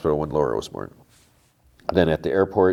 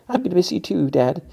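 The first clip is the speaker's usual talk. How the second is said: much higher, lightly voiced daughter, tearful goodbye; teen daughter voice; 13 semitones higher than usual. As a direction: play the daughter, pitched way up. Daughter answers father at airport.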